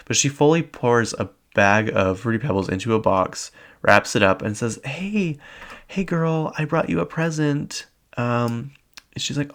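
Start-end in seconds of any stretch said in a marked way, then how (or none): 4.8-7.9 high, sugary mock-friendly greeting; fake-sweet mean girl. Mean girl presenting a prank gift, fake-sweet. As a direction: put on a higher, falsely sweet voice.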